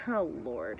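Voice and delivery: low, soft, weary "oh Lord"; exasperated aside. Retold or imitated. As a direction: drop it down under your breath. Weary reaction to his complaint.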